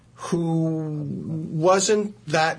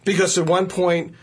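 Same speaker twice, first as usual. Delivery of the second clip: ordinary talk, nothing marked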